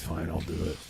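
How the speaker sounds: low, quiet gravelly wife voice. Retold or imitated. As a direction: growl it out low and rough. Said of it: "Nice gravelly voice".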